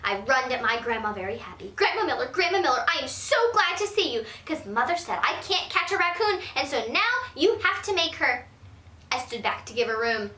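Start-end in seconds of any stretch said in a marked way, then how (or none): 1.8-8.5 put-on higher, gushing kid; eager little girl. Girl begging grandma to overrule mother, eager and high. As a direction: gush with relief.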